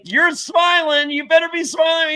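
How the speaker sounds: high, loud, crying plea; desperate shout. Retold or imitated. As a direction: beg him to bring good news.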